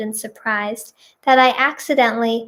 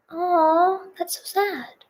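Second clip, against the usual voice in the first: soft sympathy; 6 semitones higher, 5 dB quieter, slower than usual. Real, not acted. Reacting to a sad wedding story.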